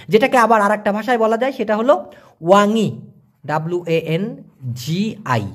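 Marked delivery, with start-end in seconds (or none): 3.5-5.5 slow, soft letter-by-letter spelling. Shown as a demonstration. Teacher to viewers spelling Malay ওয়াংই, slow letters. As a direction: spell each letter slowly and gently. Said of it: "উচ্চারণটা হবে".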